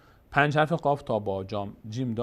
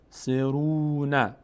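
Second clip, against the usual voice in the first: ordinary delivery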